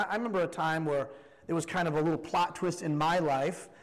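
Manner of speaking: ordinary talk, nothing marked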